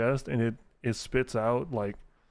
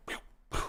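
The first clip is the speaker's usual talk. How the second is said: whispered pew pew; 7 dB quieter than usual. Retold or imitated. Imitating Iron Man firing rockets.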